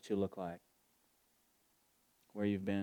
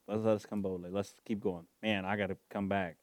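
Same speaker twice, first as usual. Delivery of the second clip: ordinary talk, nothing marked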